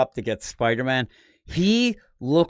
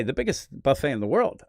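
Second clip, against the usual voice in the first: ordinary talk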